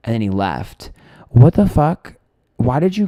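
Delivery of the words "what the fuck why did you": loud, higher-pitched burst of disbelief; exasperated outburst. Real, not acted. Vlogger venting about being grabbed on the dance floor, exasperated. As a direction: blurt it out in indignant bafflement. Outraged after necklace was grabbed.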